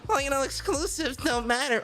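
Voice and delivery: high, nasal-free mock whine of a troll; mock troll voice for exclusives-don't-matter crowd; mocking fanboy voice. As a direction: pitch it up to mock a console troll. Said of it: "let me do the voice".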